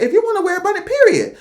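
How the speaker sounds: high-pitched quote, voice leaping up; quoted emphatic declaration. Retold or imitated. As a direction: lift it high to mimic her declaring. Quoting her, high emphatic period.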